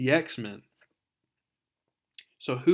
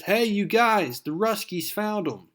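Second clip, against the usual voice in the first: high, loud henchman's call; 8 semitones higher, 4 dB louder than usual. Acted. Henchman calling to his buddies, high and loud. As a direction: shout it across to the guys.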